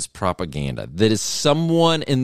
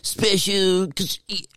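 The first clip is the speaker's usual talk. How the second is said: much higher, dazed stoner voice; stoned guy; 10 semitones higher, much slower than usual. Retold or imitated. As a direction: play a spaced-out stoner, pitched way up. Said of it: "that's how you act when you're stoned".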